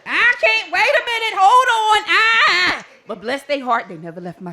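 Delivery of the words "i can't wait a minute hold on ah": high, loud, wailing pleas; mock panic. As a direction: cry out like you're losing control of the boat. Panicking with oar.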